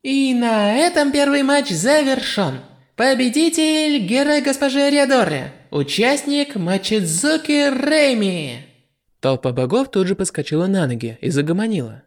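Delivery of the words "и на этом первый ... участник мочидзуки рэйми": high, slow, grand announcer call; goddess voice. A narrator's reading. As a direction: announce the winner slowly and grandly. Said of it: "раздался голос богини звука".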